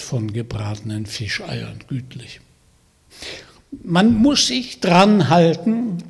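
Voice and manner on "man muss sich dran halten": chewing while talking; smacking lips. Acted. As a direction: talk through a mouthful of food. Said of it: "sagte er schmatzend".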